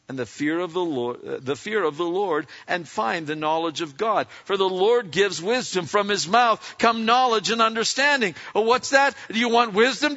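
usual speaking voice

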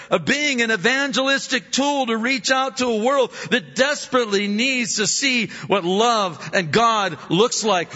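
high fervent preaching voice; booming preaching. Acted. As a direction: drive each phrase home. Preaching at full force.

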